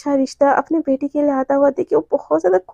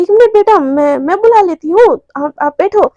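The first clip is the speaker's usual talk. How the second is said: higher, louder, rushing to stop him; panicked hurry; 4 semitones higher, 6 dB louder than usual. Acted. Radhika's mother hastily stopping Jay from entering, panicked. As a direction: blurt it out in a flustered rush.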